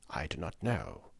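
very soft, low aside; hushed captive's reply; covert answer. A narrator's reading. Captive's covert answer to secret question.

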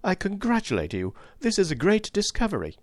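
high-pitched, bursting congratulation; excited. A narrator's reading. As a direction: let pent-up excitement burst out. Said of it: "My pent-up excitement burst forth".

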